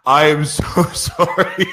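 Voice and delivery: slow, high, laughing through sorry; laughing apology. Real, not acted. Host apologizing to listeners, laughing at himself. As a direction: giggle at your own mistake. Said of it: "I'm butchering these intros".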